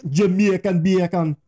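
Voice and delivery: slow, high mock Jamaican accent. Shown as a demonstration. Showing a partner how it sounds, hammed-up Jamaican accent. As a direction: draw it out in a loud put-on Jamaican accent. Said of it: "if you say it like you're Jamaican".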